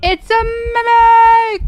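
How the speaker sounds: high, slow, loud exclamation; shocked shout. Real, not acted. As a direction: yell it out in shock. Ghost reveal shock.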